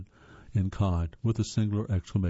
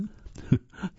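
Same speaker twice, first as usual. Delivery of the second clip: soft breathy laugh; 9 semitones higher, 3 dB quieter than usual. Real, not acted. Laughs at own stumble.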